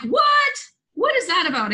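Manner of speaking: high-pitched, baffled "what?"; startled disbelief. Retold or imitated. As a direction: retell it with a jolt of confusion. Acted startled reaction in a story.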